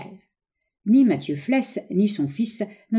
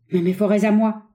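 higher, louder lively protest; sharp retort; 4 semitones higher, 5 dB louder than usual. A narrator's reading. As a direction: protest quickly for what is yours. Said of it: "répliqua le vieillard avec vivacité". (protestation vive du vieillard)

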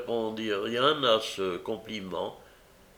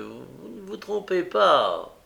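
uneasy agreement, pitched higher; worried; 7 semitones higher than usual. A narrator's reading. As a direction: repeat it with concern. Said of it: "d'un air soucieux".

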